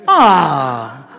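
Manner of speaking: long, loud falling "aaah"; drawn-out "ah". Acted. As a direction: let a big open "ah" slide downward. Reaction after old-timers' quote.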